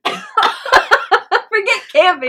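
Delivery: slow laughing dismissal. Real, not acted. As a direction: laugh it off slowly. Laughing suggestion.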